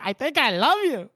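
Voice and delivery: high-pitched, put-on declaration; mock love confession. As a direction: act out a hammed-up first confession. Retold first confession, acted out.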